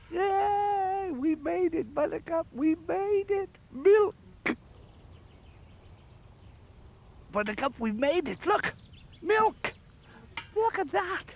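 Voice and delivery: very high, delighted yelling; excited cheering. Real, not acted. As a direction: cheer like you finally did it. Cheering after getting the milk.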